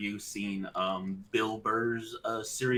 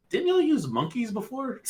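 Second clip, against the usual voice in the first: pitched way up, incredulous; high mock kid voice; 13 semitones higher, 4 dB louder than usual. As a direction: squeak it out like a doubtful kid. Mocking kid's reply, high.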